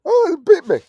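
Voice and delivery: hammed-up surprise at the bite; mock alarm. Acted snake bite victim.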